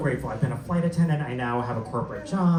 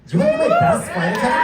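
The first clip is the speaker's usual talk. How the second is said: much higher, louder, fast and laughing; excited repeat; 6 dB louder, faster than usual. Real, not acted. Comic repeating his question after the crowd's weak yes, high and loud. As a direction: fire it back at the crowd, thrilled.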